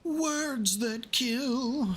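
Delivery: very slow, high, drawn-out title read; dramatic trailer voice. Acted. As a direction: stretch it like a movie tagline. Reads game title dramatically.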